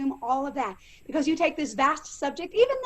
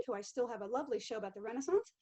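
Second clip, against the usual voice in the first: normal talking voice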